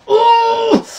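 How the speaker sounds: sharp high cry of disbelief; real startled yelp. Real, not acted. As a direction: yelp as the chance goes by. Near miss in the match.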